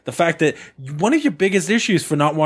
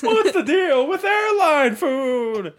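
very high, slow stand-up parody; Seinfeld; 12 semitones higher, much slower than usual. Acted. Friend parodying an observational comic's opener, high and drawn out. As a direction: stretch it out like a hammy comedian. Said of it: "Seinfeld impression".

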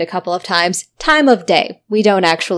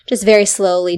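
usual speaking voice

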